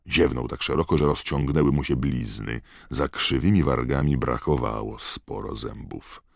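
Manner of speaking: ordinary delivery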